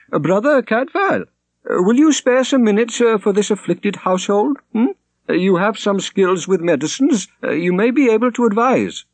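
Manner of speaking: put-on high, hesitant, agitated old priest; a flustered priest begging for help. A narrator's reading. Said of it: "issuing forth in haste and agitation".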